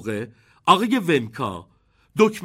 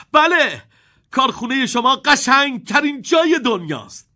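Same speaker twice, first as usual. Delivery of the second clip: put-on high, loud boyish shout; loud and eager; 9 semitones higher, 6 dB louder than usual. A narrator's reading. Charlie answers Wonka loudly, thrilled. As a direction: shout it out with delight. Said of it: "چارلی با صدای بلند گفت".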